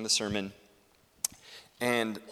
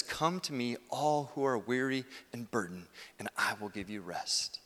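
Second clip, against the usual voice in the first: softer, gentle invitation; Jesus; 2 semitones lower, 7 dB quieter than usual. Acted. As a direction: settle down into quiet reassurance. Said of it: "Jesus says".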